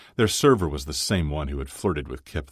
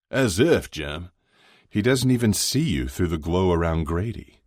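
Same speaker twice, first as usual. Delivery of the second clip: loud, higher, scoffing tease; lightly voiced hearty friend, teasing; 4 semitones higher, 5 dB louder than usual. Chuck laughs at Jimmy's chances, lightly voiced teasing. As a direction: scoff at a mate, lightly in character.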